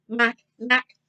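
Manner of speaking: plain reading voice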